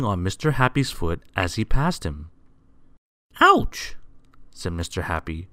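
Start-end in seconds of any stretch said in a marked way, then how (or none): 3.4-3.9 very high, loud acted "ouch"; yelp of pain. A narrator's reading. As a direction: yelp as if your foot was stepped on. Happy's foot is stepped on.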